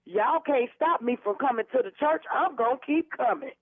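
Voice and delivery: normal talking voice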